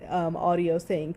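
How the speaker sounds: normal talking voice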